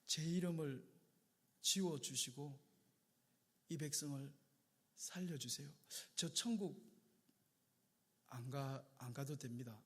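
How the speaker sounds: low, soft, halting near-whisper; hushed prayer. Acted. Moses quietly offering his own name to save the people, hushed. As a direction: pray it softly with long pauses.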